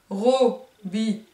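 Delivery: Robinet, drawn out and slow; slow syllable count. Shown as a demonstration. Teacher slowly saying Robinet so the kids count syllables. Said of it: "trois syllabes".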